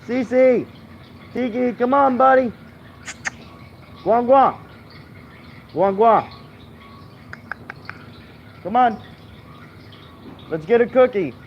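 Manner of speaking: high-pitched pet-calling voice; sing-song call to the dogs. Real, not acted.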